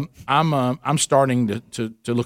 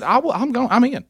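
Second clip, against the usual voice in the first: much higher, eager and bold; eager volunteer; 11 semitones higher than usual. Retold or imitated. Husband volunteers to lead the buffet charge, eager and high. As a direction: charge in like a hero. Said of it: "I said".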